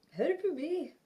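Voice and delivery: high, loud playful cry for help; mock plea. Stressed about packing, mock cry for help. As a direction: ham up a desperate call.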